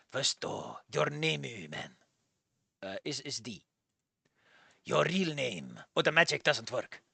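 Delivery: low, quiet old man, halting mock-Japanese; fake Japanese accent. Old man asks visitor's name, fake Japanese accent. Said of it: "end your fake Japanese accent on".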